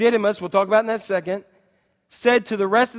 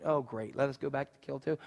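sarcasm; 6 semitones lower, 12 dB quieter than usual. Acted. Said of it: "you hear the sarcasm in that".

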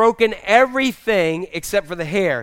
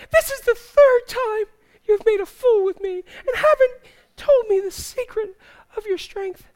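very high, pouting whine; mock-tearful falsetto seductress; whiny seductress; 13 semitones higher than usual. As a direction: plead like a spoiled temptress, hammed-up. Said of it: "gotta use my best whiny, seductress voice".

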